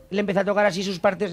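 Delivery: ordinary talk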